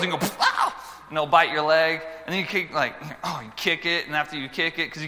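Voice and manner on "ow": high sudden yelp of pain; mock yelp. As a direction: cry out as if bitten. Acting being bitten.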